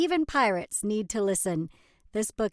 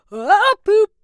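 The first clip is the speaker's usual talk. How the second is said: high loud squeal of disgust; ah poop cried out in mock horror; mock disgusted yelp; 10 semitones higher, 13 dB louder than usual. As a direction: shriek it like a grossed-out pirate. Reader exclaims at poop joke.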